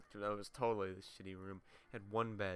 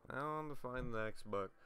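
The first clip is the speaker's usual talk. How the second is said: usual speaking voice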